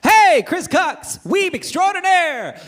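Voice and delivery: high, loud, drawn-out hailing call; mock greeting yell. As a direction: hail him like a showman announcing a star. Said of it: "every time this man calls me, it is with the words".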